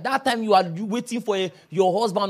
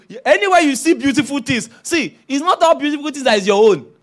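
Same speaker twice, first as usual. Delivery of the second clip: ordinary talk, nothing marked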